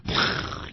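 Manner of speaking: voiceless puff of escaping smoke; airy whoosh sound effect; imitated smoke whoosh. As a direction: blow out a whoosh like released smoke.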